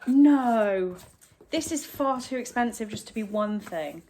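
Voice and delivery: ordinary talk, nothing marked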